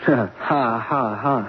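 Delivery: mirthless, spaced-out "ha, ha, ha"; hollow laugh. Acted. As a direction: scoff with a dry mock laugh. Broker scoffs at paying the loss.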